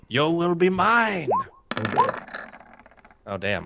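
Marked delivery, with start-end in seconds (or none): 0.1-1.3 higher, drawn-out theatrical charm; enchanter's spell. Acted. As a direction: intone it slowly like a spellcaster. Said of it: "And by that I mean".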